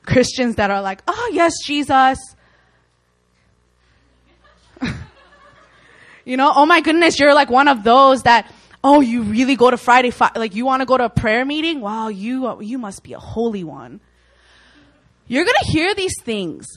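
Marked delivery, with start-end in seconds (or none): 0.0-2.4 scoffing, sing-song taunt; mocking critic. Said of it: "mock you and be like". 6.5-14.0 loud, high scoffing disbelief that fades at the end; mocking critic. Critic mocking a friend for going to prayer meetings, loud scoffing voice. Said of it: "mock you and be like".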